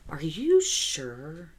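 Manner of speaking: slow, drawn-out, doubting question; imitating husband's mock gaslighting doubt; Patrick's doubtful tone. Husband teasing her on the phone, slow doubting tone. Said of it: "And this is the tone Patrick used".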